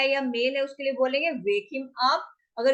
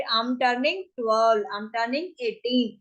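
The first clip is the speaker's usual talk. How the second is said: slow demo sentences; much slower than usual. Shown as a demonstration. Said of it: "teacher models English sentences".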